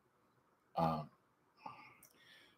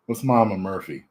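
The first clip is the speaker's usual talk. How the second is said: usual speaking voice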